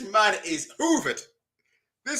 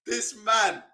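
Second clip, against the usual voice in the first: ordinary talk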